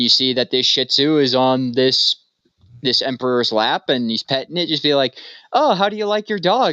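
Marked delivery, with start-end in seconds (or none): 5.5-6.7 high, bright-eyed small-talk question; lightly voiced visitor. Visitor at the palace asking the emperor about his dog, high and mock-friendly. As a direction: ask like a guest faking friendly interest.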